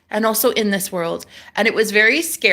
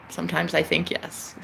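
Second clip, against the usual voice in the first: ordinary delivery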